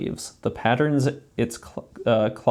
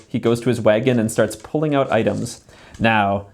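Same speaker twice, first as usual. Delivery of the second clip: ordinary talk, nothing marked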